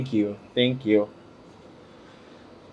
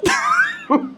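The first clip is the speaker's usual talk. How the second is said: short high squeal of laughter; laughing fit. Real, not acted. Vlogger laughing at how hot it is, high squealed laugh.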